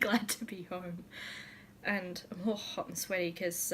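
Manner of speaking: ordinary talk, nothing marked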